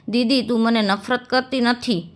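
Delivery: plain reading voice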